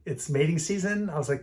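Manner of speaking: ordinary talk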